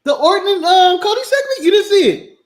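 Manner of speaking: high, loud disbelieving questions; surprised. Real, not acted. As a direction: react in genuine disbelief.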